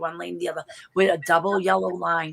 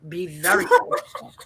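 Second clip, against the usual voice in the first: laughing warning; 11 semitones higher than usual. Retold or imitated.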